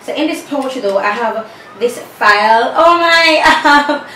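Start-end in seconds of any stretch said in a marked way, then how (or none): 2.8-4.1 surprised. Real, not acted. Excited reaction to fashion file.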